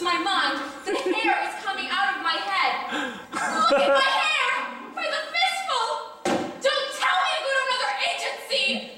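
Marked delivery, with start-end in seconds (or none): 3.3-5.9 shrill hysterical outburst, much higher; comic hysteria over her hair. Acted. Hysterical client in comedy sketch. 6.6-8.9 frantic. Acted. Frantic client protesting.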